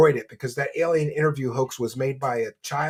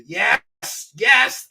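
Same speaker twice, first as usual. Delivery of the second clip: excited yes; 7 semitones higher, 6 dB louder than usual. Real, not acted. Cheering a donation.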